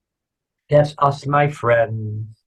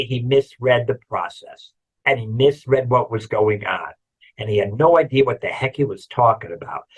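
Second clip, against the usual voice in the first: ordinary talk, nothing marked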